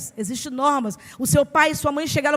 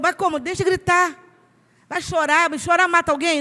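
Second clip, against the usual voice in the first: mock alarmed mother; 6 semitones higher, 4 dB louder than usual. Paciente imitada, aflita.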